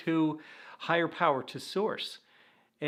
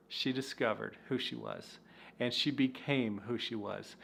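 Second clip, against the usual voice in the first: softer, choked-up delivery; getting emotional; 5 semitones lower, 6 dB quieter than usual. Real, not acted. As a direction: let real emotion pull the voice down. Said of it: "I'm getting a little bit emotional".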